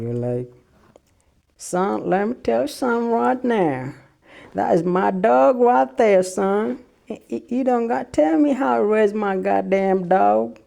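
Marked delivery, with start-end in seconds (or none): 1.6-10.6 put-on Texas accent. Dog owner telling off nosy neighbour, loud Texas accent. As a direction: bark it like an angry Texan rancher. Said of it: "a little bit of Texas comes out in you".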